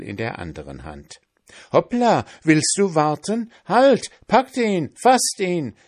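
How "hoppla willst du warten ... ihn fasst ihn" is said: put-on high, loud old women yelling; shouting old sisters. A narrator's reading. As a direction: yell at the runaway together. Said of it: "schrien sie durcheinander".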